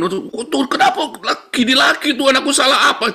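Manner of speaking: high, loud mock wail to God; mock complaining prayer. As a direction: imitate someone protesting to God. Mimics a doubting, complaining prayer.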